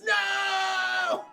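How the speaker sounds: long high wail to the heavens; drawn-out theatrical yell; mock scream. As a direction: cry out in hammed-up anguish. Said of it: "he screams at the heavens".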